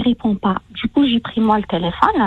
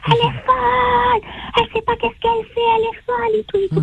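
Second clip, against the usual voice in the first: high, frantic mock shout, racing through the words; retold furious yell; 14 semitones higher than usual. Husband hearing divorce news on speakerphone, imitated high furious yell. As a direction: imitate the husband screaming down the phone. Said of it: "Et là, il hurle".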